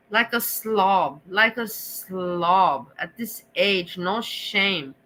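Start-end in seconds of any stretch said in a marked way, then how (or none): 0.1-2.9 very slow, stretched-out contempt; drawn-out disgust. Real, not acted. Streamer judging a man's behaviour on camera, disgusted. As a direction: drag out each word in disapproval. Disgusted rant about a man.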